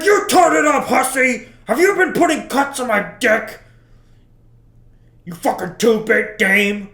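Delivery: loud, high, yelling insults at her; furious shout. Retold or imitated. Husband confronting wife over breakfast, furious shout. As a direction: blow up at her across the table.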